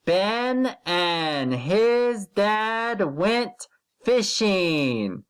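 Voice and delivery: slow, drawn-out word-by-word reading; slow model reading. Shown as a demonstration. Teacher slowly modelling a sentence for first graders to repeat. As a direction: stretch each word out for the class. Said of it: "Say it with me".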